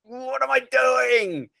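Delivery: very high, slow, drawn-out wail; despairing inner whine. Retold or imitated. Telling the host about a bad day, a high despairing whine. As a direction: stretch it into a high, helpless whine. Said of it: "And I'm like".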